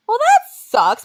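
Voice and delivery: high, slow whiny disappointment; mock disappointment. Complaining to Noah about the playlist, high whiny letdown. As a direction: draw it out like a let-down kid.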